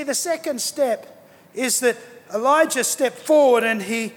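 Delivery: ordinary delivery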